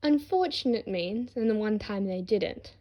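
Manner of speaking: plain reading voice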